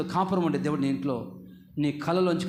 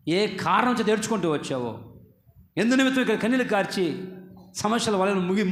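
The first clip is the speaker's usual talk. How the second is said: ordinary talk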